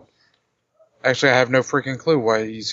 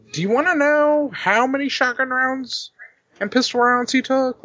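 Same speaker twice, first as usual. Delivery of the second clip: excited boast; 13 semitones higher than usual. Acted. Boasting about fight.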